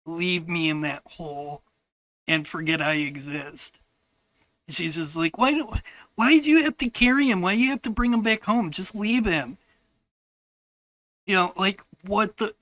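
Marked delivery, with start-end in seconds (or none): 5.4-9.6 put-on high, fast child's complaint, easing down; mimicked nagging. Sister to Grandpa carrying brother, fast mimicked nagging. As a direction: imitate a kid protesting in a rush.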